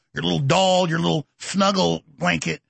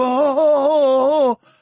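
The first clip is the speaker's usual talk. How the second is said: high, drawn-out mocking "oh"; mock imam wailing; 8 semitones higher, 5 dB louder, slower than usual. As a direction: stretch out loud, hammed-up moans in mockery. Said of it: "So here he is with his imam".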